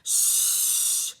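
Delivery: loud unvoiced shush; annoyed neighbors shushing. A narrator's reading. Reader as neighbors shushing the cats. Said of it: "they all said".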